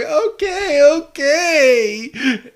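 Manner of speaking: very high, whimpering surrender; mock frightened. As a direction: imitate a cowed little creature giving in. Scared Ditto giving in to threat.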